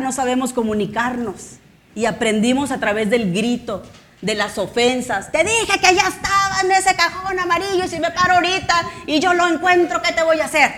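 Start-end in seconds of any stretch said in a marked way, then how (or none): 5.3-10.7 high, exasperated parent yelling. Retold or imitated. Parent yelling at child about the yellow drawer, hammed-up yell. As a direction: bark it at the kid who can't find it. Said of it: "pero no hablaste, gritaste".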